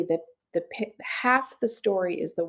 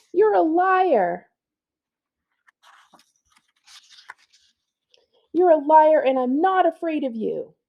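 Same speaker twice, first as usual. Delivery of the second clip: put-on defiant girl; 9 semitones higher, 4 dB louder than usual. Girl shouts defiantly at the witch. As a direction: stand up to her, loud and stubborn.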